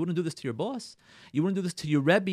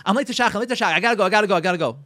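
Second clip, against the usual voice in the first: loud, higher rush of repeated excuses; mock hurried exit; 4 semitones higher, 6 dB louder, much faster than usual. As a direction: call it out as you rush off. Acted husband rushing out the door.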